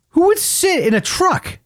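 very high, slow incredulous question; exasperated disbelief. Real, not acted. Asking friends who would do such a thing, high and exasperated. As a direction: stretch it out in utter bafflement. Baffled by stranger in his truck.

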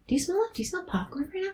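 very high, soft, curious questioning; hushed, high, rising mock wonder; playful distraction. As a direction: act out sniffing out a mystery in a tiny high voice. Acts out distracting sniffing question.

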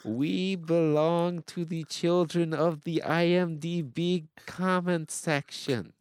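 high, slow, drawn-out put-on accent; hammed-up foreign-sounding accent, stretch every word; Tom Hanks movie accent. Co-host asked for the movie accent, exaggerated put-on accent. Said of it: "in your best, um, Tom Hanks in this movie accent".

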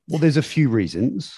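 high-pitched, lightly mocked considered answer; teasing take on a practical dad; deliberating dad. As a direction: imitate a methodical dad starting his explanation. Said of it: "he thinks about it and he's like".

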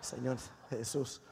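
very low, hushed aside; muttered mock prayer. Preacher despairs of congregation's tax answer, muttering low. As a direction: sigh a quiet plea to heaven.

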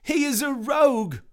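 very high, loud character voice; shrieky high-pitched accusation; declaring accuser. Acted. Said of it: "Mister Liar declared".

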